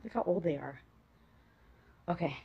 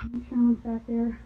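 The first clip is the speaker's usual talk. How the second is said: ordinary talk, nothing marked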